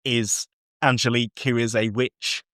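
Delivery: ordinary talk, nothing marked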